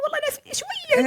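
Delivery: put-on sick old father. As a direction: play the father shrugging off his pain, high-pitched. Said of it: "بيقول".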